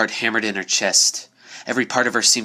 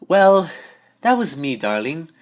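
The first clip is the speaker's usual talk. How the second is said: higher, refined, awkward apology; smooth it over with a genteel fluster; Rarity owning up to the prank, refined and sheepish; 7 semitones higher than usual. Acted. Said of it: "Rarity chuckled uncomfortably".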